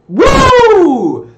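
high, loud, held "woo"; hype whoop. Real, not acted. Streamer opens the gameplay stream, whooping loudly. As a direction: whoop long and high to fire up viewers.

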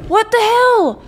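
high, loud what the hell; high indignant outburst; outraged. Real, not acted. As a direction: shriek in disbelief. Outraged at theft.

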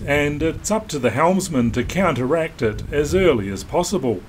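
even, measured documentary read; calm voiceover read to the viewers; vlog voiceover. Acted. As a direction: narrate the passage like a sailing film.

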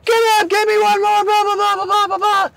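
high, strained rapid-fire mock yell; mock screaming. Warrant screaming at stuck trainee, high mock scream. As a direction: imitate an instructor's relentless screaming. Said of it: "Warrant screaming at me".

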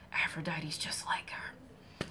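slow, hushed confiding whisper; slow gossipy whisper to viewers; whispered aside. Real, not acted. Aside comparing to Monster-in-Law.

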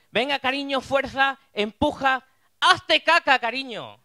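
high, loud urging push; shouting midwife. Retold or imitated. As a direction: yell encouragement at the mother.

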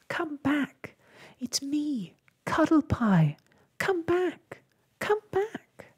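very high, thin distant call. A narrator's reading. Cuddlepie begs Ragged Blossom to return, calling out. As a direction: call desperately after someone, pitch climbing high. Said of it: "he called".

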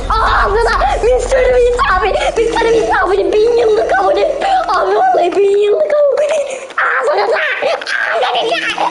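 high, laughing yells; laughing excited shouting. Real, not acted. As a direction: shriek with giddy delight.

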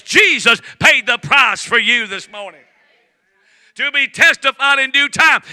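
loud, high, shouting revival preacher; fiery preaching. Acted. Pastor rousing the church about Jesus paying the price, shouting sermon. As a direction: preach it hard to the congregation, rising to shouts.

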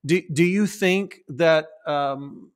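usual speaking voice

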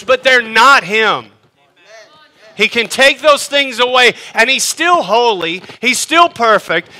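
full-force preaching. Real, not acted. Pastor pressing the congregation that God is still holy, preaching hard. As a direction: preach it out with rising fire.

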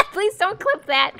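high, loud cackling laughter; laughing hard. Real, not acted. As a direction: plead through big bursts of laughter. Laughing at own thirsty remark.

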